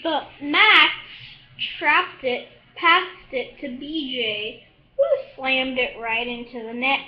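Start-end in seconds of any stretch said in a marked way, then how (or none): none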